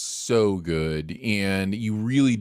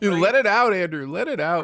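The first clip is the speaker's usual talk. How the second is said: much higher and louder teasing urge; playful encouragement; 10 semitones higher, 4 dB louder than usual. Real, not acted. Co-host teasing his emotional friend, high and loud. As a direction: egg him on to cry, grinning.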